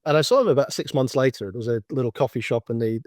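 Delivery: ordinary talk, nothing marked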